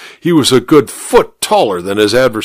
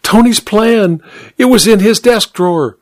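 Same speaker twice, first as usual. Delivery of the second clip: high-pitched, urgent housemaid; acted, agitated revelation; excited cry; 10 semitones higher than usual. A narrator's reading. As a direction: blurt out the find in a high voice. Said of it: "she cried".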